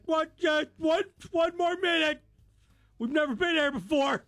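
high, pleading flustered voice; flustered dad. Retold or imitated. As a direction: stall the worker. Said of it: "I was like".

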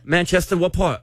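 high put-on British; amateur British accent. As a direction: quiz them in a mock-British voice. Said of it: "Y'all have a British off right now".

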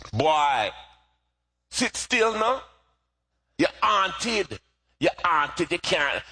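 put-on scolding mother, Jamaican accent, high. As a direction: Jamaican mother telling her boy off. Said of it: "my mother would say this to me in her Jamaican accent".

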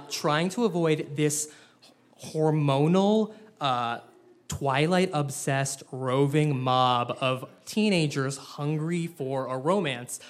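ordinary talk, nothing marked

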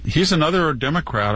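ordinary delivery

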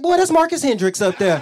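high, loud excited exclamation; excited call-out. Retold or imitated. Telling someone who is up there, excited and loud. Acting out own excited reaction.

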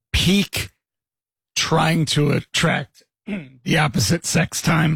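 hoarse, throaty rasp; raspy voice. Real, not acted. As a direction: speak through a raspy throat. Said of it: "this sexy rasp voice".

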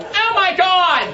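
very high, slow shriek of a panicking passenger; freaking-out lady. Retold or imitated. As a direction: squeal it like a lady losing it. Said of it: "She was like".

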